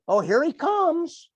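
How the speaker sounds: a doubter scoffing as he calls again, slow and mocking; sarcastic mock. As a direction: drag it out like a jeering skeptic. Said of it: "how many people tell me".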